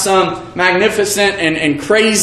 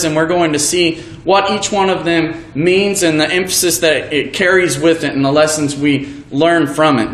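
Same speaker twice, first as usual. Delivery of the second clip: normal talking voice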